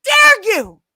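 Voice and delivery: high, loud, outraged; mock outrage. Scolding her school friend, mock outraged. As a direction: hit them with theatrical offence. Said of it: "And I said".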